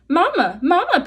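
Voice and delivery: gosling calling the robot mama; peeping gosling. Acted. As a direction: peep it like a baby bird. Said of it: "peeped the gosling".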